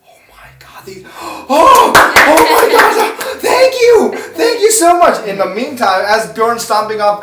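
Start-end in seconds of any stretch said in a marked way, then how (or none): 0.0-1.4 breathless whispered gasp; awed whisper. Acted. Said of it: "And all of the people are like". 1.5-5.2 much higher and louder, stretched out slow; gushing gratitude. Acted. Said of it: "And all of the people are like".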